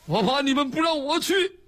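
high, whiny mock-tearful plea; hammed-up sobby complaint; tearful protest. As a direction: blurt it out as if still bawling. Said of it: "哭什么？".

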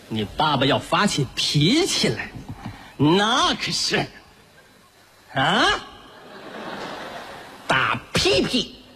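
put-on slow, drawn-out toddler talk; baby-talk threat. Father slowly warns his toddler of a spanking. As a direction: stretch every word for a little kid.

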